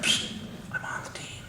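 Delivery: secret unvoiced whisper. Hollywood colleague confiding to speaker, whispered. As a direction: breathe it like a secret. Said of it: "Why are you whispering?".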